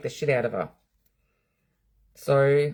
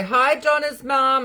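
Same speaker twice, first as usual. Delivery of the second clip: high, slow, drawn-out hello for everyone to copy; sing-song group greeting; 10 semitones higher, 5 dB louder, slower than usual. Shown as a demonstration. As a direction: lead the chat in a big hello. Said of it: "We should all say".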